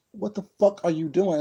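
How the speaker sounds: a son's furious demand at his mother; angry outburst. Retold or imitated. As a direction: blurt it out in shocked anger. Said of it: "I just looked at her like".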